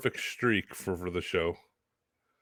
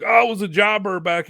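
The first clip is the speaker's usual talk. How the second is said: higher, louder mock braggart voice; boastful ex-jobber; 10 semitones higher, 7 dB louder than usual. Cohost mimicking local guys claiming a wrestling past, mock boasting. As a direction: imitate a guy loudly talking himself up.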